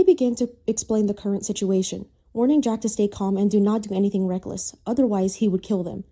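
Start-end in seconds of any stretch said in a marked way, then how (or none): none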